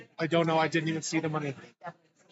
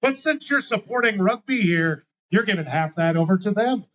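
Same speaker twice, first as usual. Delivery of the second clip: loud call across the bar; loud announcement; 3 semitones higher, 9 dB louder than usual. Acted. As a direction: announce it to the whole room. Announcing pot to whole bar.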